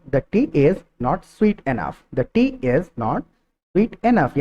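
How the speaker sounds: very slow, word by word, the end lifted on sweet; slow model sentence. Shown as a demonstration. Teacher modelling the natural phrase for students, very slow. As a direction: drag it out so learners catch each word. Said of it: "इसको हम कहेंगे".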